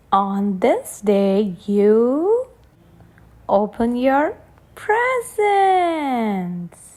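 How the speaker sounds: slow, lilting picture-book reading; reading to children. A narrator's reading. Reading a birthday book to a child, slow storybook voice. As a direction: read it slowly to a little one on their birthday.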